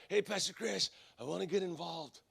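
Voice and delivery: lightly voiced soft tough guy; a hint of a quiet mobster; Glenn. Intimidating churchgoer approaching his pastor, lightly imitated hushed voice. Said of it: "he talks like this".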